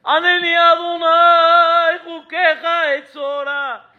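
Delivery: high, slow, drawn-out prayer cry. Retold or imitated. Congregation at Neilá, a drawn-out shouted plea. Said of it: "nos escuche gritando a todos".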